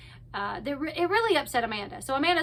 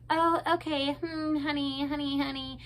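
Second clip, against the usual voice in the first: put-on little baby tone; 2 semitones higher, 4 dB quieter than usual. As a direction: imitate a cutesy, talking-down baby voice. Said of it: "going in that little baby tone".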